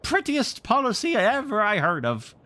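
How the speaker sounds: high, loud admiring exclamation; admiring cry. A narrator's reading. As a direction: gush with awe at the plan. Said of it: "he cried".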